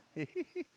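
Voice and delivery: short, high-pitched chuckle; high giggle. Real, not acted. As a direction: giggle high at the racers' duel. Amused by the racers' duel.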